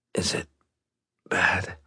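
weak whisper. Acted. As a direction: breathe it out faintly. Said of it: "he whispered".

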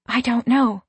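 ordinary delivery

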